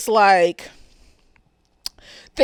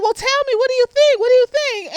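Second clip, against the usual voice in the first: much higher, eager repeated pestering; pleading Janine; 10 semitones higher than usual. Retold or imitated. Janine pressing Barbara for her opinion, high and eager. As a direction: beg for an answer, eager and high.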